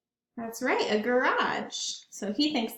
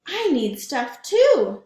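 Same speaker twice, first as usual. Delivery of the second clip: eager bear; 3 semitones higher, 9 dB louder, slower than usual. Acted. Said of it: "said Big Brown Bear".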